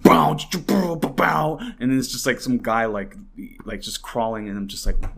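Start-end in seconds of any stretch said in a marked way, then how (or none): 0.0-1.6 loud, high "bow, bow" bass drops; beatboxed dubstep drop; imitated dubstep bass. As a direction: mimic the dubstep wub on the clip. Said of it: "dubstep music on it".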